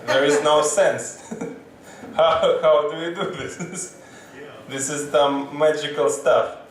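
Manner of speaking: usual speaking voice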